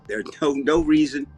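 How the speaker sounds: ordinary talk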